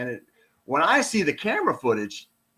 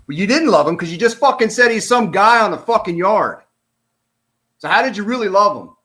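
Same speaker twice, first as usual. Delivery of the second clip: louder, higher, indignant retort; exasperated outburst at the witness; heated rant; 5 semitones higher, 6 dB louder than usual. Real, not acted. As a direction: call out the witness, fired up. Rant at witness, then apologizes.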